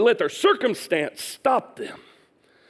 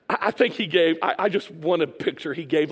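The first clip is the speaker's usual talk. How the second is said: normal talking voice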